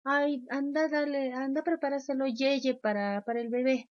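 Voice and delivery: más aguda, mimosa para el bebé; voz aniñada de mamá. Retold or imitated. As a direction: imita a la mamá que le habla al bebé. Padre habla como bebé. (mamá hablando con voz de bebé)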